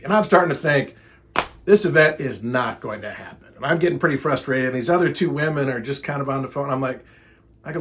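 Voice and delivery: ordinary talk, nothing marked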